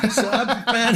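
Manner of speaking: laughing mid-sentence; breaking into laughter. Real, not acted. Laughing at the memory.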